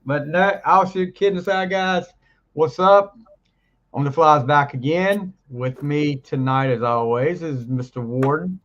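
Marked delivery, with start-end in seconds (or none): none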